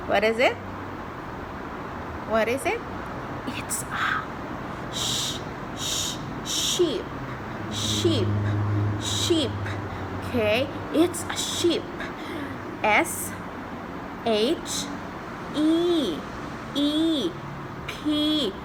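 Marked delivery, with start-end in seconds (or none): 3.5-6.2 unvoiced whispered sh- sh-; whispered sh sound. Shown as a demonstration. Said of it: "S H sound is sh". 16.8-18.5 put-on high singsong for a toddler; reading to a toddler.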